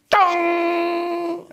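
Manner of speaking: long, high held "tonnng"; high droning machine sound; machine beep imitation. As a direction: imitate an appliance tone, stretched out. Imitates the washing machine button sound.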